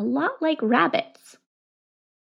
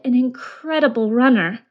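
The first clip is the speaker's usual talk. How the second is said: ordinary delivery, nothing marked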